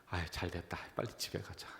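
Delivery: quietly glad to escape; relieved muttering. Retold or imitated. Pastor recalls relief leaving the hospital, hushed. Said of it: "그 생각이 있었어요".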